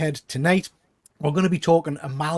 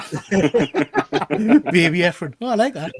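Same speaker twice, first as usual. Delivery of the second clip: laughing; 3 dB louder, much slower than usual. Real, not acted. Laughing at the Baby Reindeer joke.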